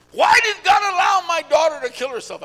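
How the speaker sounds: acted high, loud cry of anguish; anguished outcry. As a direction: demand an answer like a bereaved mother. Retold bereaved mother questioning God.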